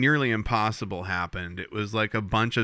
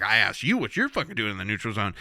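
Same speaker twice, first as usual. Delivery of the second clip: fast, hint of an indignant captain; lightly voiced Picard; faster than usual. Host voicing Picard answering the Romulans, lightly voiced and fast. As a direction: throw it back at her. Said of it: "He's like".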